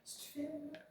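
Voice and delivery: breathless whisper. Real, not acted. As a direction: whisper the question nervously. Whispered question in the dark.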